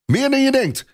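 put-on a small boy bragging to grown men; boastful boy. A narrator's reading. Boy voiced in story.

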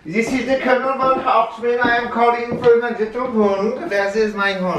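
loud, high German colonel announcing himself; booming fake-German military proclamation; mock German officer. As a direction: holler for the dog in a put-on German accent. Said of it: "speaking in the German accent".

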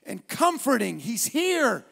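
loud, high, shouted preaching building to song; preaching at full force. Acted. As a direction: preach it out with rising fire.

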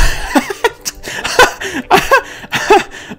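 high, wheezy helpless laughter; real giggling fit. Real, not acted. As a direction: crack up in high breaking giggles. Laughs at hitting people.

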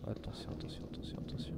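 hushed warning whisper. Real, not acted. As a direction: breathe the warning under your breath. Sneaking in the dark.

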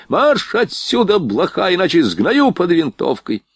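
high, drawn-out furious order; raging officer, pitch way up; enraged. A narrator's reading. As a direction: order him out with a threat. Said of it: "Поручик в бешенстве".

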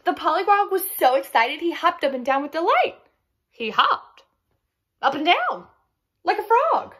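high, loud storytelling, punched in bursts; excited narration. A narrator's reading. Narrator tells of pollywog hopping with delight, excited. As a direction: bounce through it with delight.

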